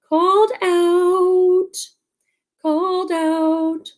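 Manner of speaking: mock-embarrassed singsong; playful sing-song. Real, not acted. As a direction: drag it out like a mock-wounded tease. Teased about her accent.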